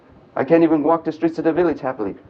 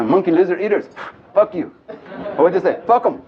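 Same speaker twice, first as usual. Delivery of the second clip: higher, scornful jeer with a laugh; scornful clan taunt; 5 semitones higher than usual. Retold or imitated. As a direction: sneer at the monkey and lizard eaters. Mocking rival clan taunt.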